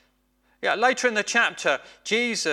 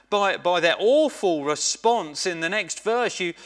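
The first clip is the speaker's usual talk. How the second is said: ordinary delivery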